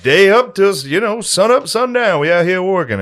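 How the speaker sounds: high, loud put-on storytelling voice; mock reenactor lament. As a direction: play the reenactor describing the hard days.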